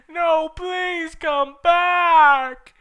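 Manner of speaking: high, loud, slow plea; pleading wail. Acted. Draco begging Ebony to return, high slow wailing plea. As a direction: beg her to come back. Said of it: "shouted Draco sadly".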